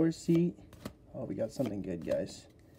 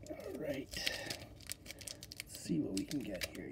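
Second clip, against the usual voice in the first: whispering; 12 dB quieter, slower than usual. Real, not acted. Whispered opening of pack.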